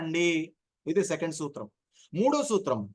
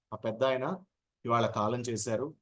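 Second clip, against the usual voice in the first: solemn condolence; 7 semitones lower, 5 dB quieter than usual. Shown as a demonstration. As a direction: lower and softer, with pauses. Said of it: "ఇలా పాజులు ఇచ్చి చెప్తాం". (సంతాప సభలో నెమ్మదిగా, విరామాలతో)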